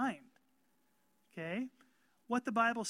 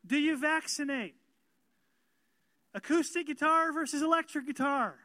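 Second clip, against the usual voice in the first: louder, higher, fervent pulpit delivery; preaching at full force; 5 semitones higher, 5 dB louder than usual. Acted. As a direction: drive it home to the congregation.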